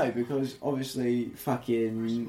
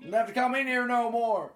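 higher, hammed-up cowboy bully line; mock western bully; 11 semitones higher than usual. Quoting Biff's ancestor to a friend, hammed-up bully. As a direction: sneer it like a saloon bully.

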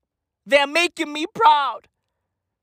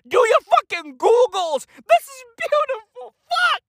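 overjoyed; 9 semitones higher than usual. Real, not acted. Overjoyed high exclamation.

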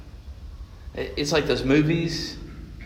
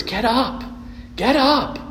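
rising, higher pleading to the dead; pleading mourner; 8 semitones higher than usual. Retold or imitated. Grieving mourner begging a corpse to rise, pleading. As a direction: beg the body to get up, each time higher.

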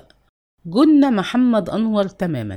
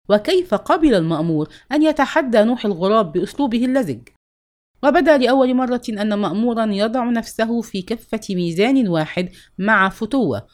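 plain reading voice

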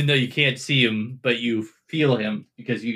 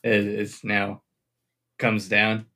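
usual speaking voice

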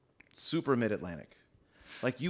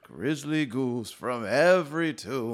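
Vincent Price voice; 2 semitones higher, 5 dB louder, much slower than usual. Retold or imitated. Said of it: "that's super Mid-Atlantic".

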